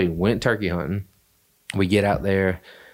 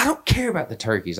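higher, whiny retort; high protest; 7 semitones higher than usual. Retold or imitated. Brother whining high about the turkeys. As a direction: squeak it out indignantly. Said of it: "He's like".

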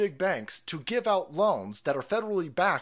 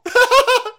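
short high shriek of laughter; high laugh burst; 15 dB louder than usual. Real, not acted. Laughing at the quote.

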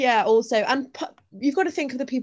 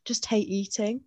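retold weary complaint; 4 dB quieter, much slower than usual. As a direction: sigh out the "Oh, God" as if imitating him. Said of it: "he sort of sat there and went".

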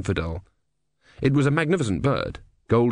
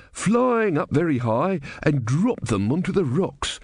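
put-on slow, higher-pitched old bore; boring elderly man; 6 semitones higher, slower than usual. A narrator's reading. Elderly man explaining how eagles eat tortoises, boring and unstoppable. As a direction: drone on with relish through a tedious fact. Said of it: "in a kind of boring, unstoppable voice".

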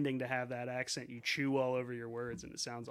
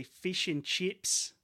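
Australian "fish and chips", slow, higher and rising; Australian accent; 5 semitones higher, much slower than usual. Shown as a demonstration. Podcaster demonstrating the test phrase for telling accents apart, Australian accent. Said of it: "they're from Australia".

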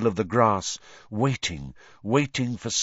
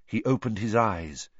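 ordinary delivery

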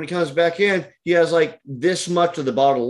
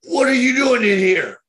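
high, loud shout at the cop; angry shout; 9 semitones higher, 4 dB louder than usual. Retold or imitated. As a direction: yell it at him, outraged. Drunk customer confronts the cop.

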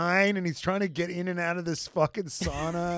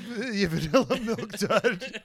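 laughing; 4 semitones higher than usual. Real, not acted. Laughing repeat.

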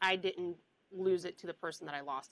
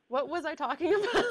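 laughing; 8 semitones higher, 3 dB louder, faster than usual. Real, not acted. Laughs while losing track.

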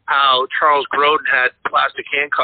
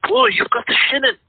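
much higher, put-on old caretaker voice; Scatman Crothers impression; 11 semitones higher than usual. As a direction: ham up the knowing old caretaker, pitched way up. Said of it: "[name] plays Scatman Crothers".

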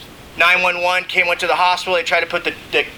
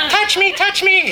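high, slow, laughing plea to be touched; mock needy squeak through a laugh; needy soft guy; slower than usual. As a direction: whine it like a touchy-feely softie, much higher. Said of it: "I'm like a sensitive, soft guy".

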